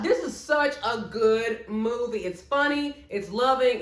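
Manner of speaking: ordinary talk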